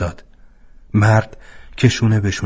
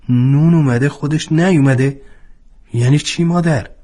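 put-on a louder, higher boy's puzzled question; astonished; 7 semitones higher, 4 dB louder than usual. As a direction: ask in disbelief, pitch climbing. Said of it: "با تعجب پرسید".

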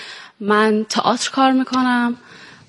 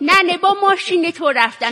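high-pitched grandmother voice; elderly woman character voice; old granny; 6 semitones higher than usual. Acted. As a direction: answer as a doting old granny. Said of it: "چرا پیر شدی؟".

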